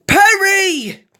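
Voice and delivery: very high, loud, drawn-out holler; long piercing call; shouted in desperation. A narrator's reading. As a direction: yell the name to be heard at last. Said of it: "All three of them shouted".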